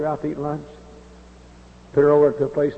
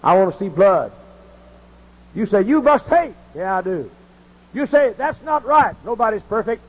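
impassioned preaching; 9 semitones higher than usual. Acted. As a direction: shout your love of country from the pulpit.

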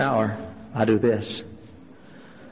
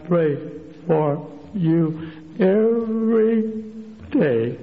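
very slow, laboured imitation, words stretched; talks like this; 2 semitones higher, much slower than usual. Preacher imitating an ailing woman promising prayer, slow and laboured. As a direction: drag each word out as if speech is hard. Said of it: "she talks like this".

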